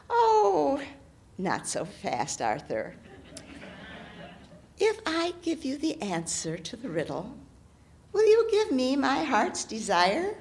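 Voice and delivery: lightly voiced hag bargaining. Hag character voice.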